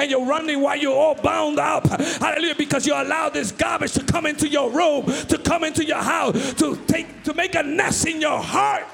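from steady talk up to loud preaching shouts; preaching building to shouting. Acted. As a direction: climb until you are shouting the sermon.

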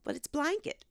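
put-on high, soft little-kid protest; daughter insisting. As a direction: imitate a child insisting on bly-nket. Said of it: "And she goes".

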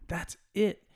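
That's it with the word it lifted; emphatic agreement. Retold or imitated. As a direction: land it harder the second time. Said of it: "I'm like".

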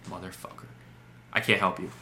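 imitating a weary tech, pausing before "I can't help you"; low, quiet mock reply with a long pause before "I can't help you". Retelling a tech support call, pausing long before "I can't help you". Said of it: "he would pause longer, like".

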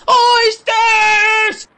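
high, loud pleading shout; boy crying for oysters to his father; desperate cry. A narrator's reading. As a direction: cry out begging. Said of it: "I cried".

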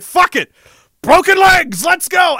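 shouted self-pep, all in; fired-up shout. Retold or imitated. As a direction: roar it like you just snapped and stopped caring. Psyching himself up to continue.

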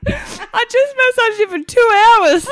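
slow, high, loud imitation; mock exasperated protest. Imitating partner protesting after massaging her, slow loud mock exasperation. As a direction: draw out the protest loudly, amused.

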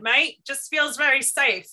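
British accent. Shown as a demonstration. Photographer showing her nervous habit, mock British accent. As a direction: slip into a playful British accent. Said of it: "go into a British accent".

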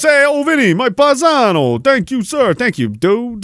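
hammed-up wiseguy greeting, pitched high; Italian-American mobster. Streamer thanking a donor in a put-on wiseguy voice. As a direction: ham up a New York mob guy thanking his pal.

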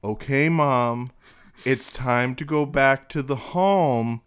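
hammed-up loud "time to go back to the home"; loud slow announcement. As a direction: sons pranking their mother in a restaurant, loud and slow. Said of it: "right out loud".

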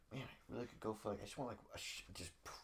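barely audible, inward sigh of longing; hushed craving. Retold or imitated. Nervous before the presentation, wishing for a shot, hushed. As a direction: mutter the wish under your breath.

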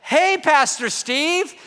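calling out across the skate park, drawn out and high; a fan spotting him at the skate park, calling out high and loud; loud cheery greeting. Retold or imitated. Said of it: "someone shows up ... and goes like".

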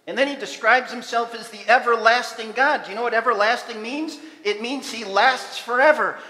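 loud, driving sermon voice; full-force preaching. Acted. Pastor proclaims God's everlasting presence, loud and fervent. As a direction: preach it to the back row.

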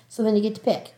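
ordinary delivery

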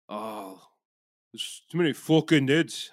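put-on a clumsy stab at a Scottish accent; bad Scottish accent. Tourist mimicking Glasgow locals in a poor Scottish accent. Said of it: "still can't do the Scottish accent".

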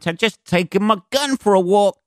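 high, jokey imitation of a swaggering gun owner; lightly mocking American gun-carrier; mock gun guy.

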